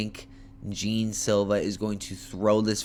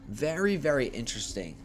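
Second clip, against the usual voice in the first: ordinary talk, nothing marked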